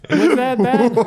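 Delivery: high squeaky laughing question. Real, not acted. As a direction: laugh through the words. Laughing disbelief.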